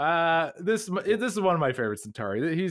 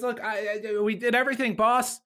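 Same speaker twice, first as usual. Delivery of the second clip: high-pitched, defensive pleading act; making excuses; 8 semitones higher, faster than usual. Retold or imitated. Garibaldi gives his boss an excuse, high defensive pleading. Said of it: "Garibaldi makes up an excuse".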